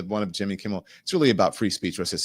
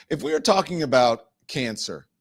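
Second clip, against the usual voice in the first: ordinary talk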